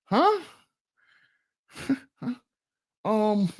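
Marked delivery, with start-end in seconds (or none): 0.0-0.4 mock startled. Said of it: "You're gonna be like".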